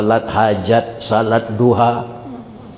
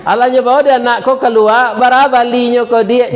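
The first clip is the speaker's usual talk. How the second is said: excited telling; 10 semitones higher than usual. Retold or imitated. Preacher to the congregation, pitched up and excited. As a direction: lift it up with excitement.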